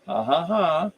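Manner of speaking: high-pitched "ha ha ha"; spoken mock laughter. Shown as a demonstration. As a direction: read the laugh out in separate beats.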